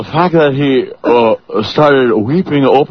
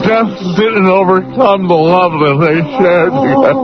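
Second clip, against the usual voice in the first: higher, wailing mock sob about the movie; hammed-up fake sobbing; 8 semitones higher than usual. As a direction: blubber through it like a fake mourner. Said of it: "I'm crying like Dan".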